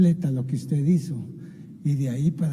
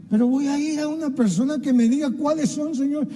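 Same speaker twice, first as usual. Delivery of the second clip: much higher, pleading prayer voice; 10 semitones higher than usual. Pretend. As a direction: cry out to the Lord, high and earnest. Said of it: "en obediencia al Señor y decirle".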